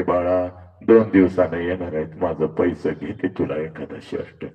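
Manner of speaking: low, soft consoling whisper; mother consoling her crying son, very soft; tender mother. Acted. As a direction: hush him gently, low and soft.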